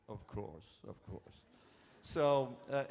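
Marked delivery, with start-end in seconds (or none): 0.1-1.4 soft, low, gentle big man; a hint of a soft-spoken giant. Andre quoted agreeing kindly.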